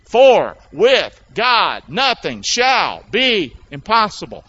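very slow, loud and deliberate; unison recitation. Shown as a demonstration. Preacher leads church in reciting the verse, slow and loud. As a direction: lead the church word by word. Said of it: "Say it with me".

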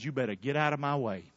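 ordinary talk

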